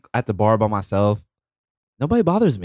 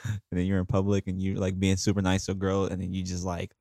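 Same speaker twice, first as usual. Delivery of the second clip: usual speaking voice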